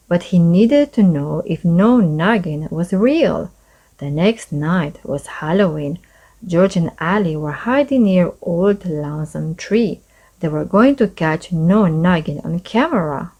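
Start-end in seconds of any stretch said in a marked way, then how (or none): none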